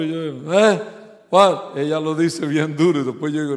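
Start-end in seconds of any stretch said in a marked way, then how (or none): 0.5-1.7 loud what. Retold or imitated. Deaf husband asks what.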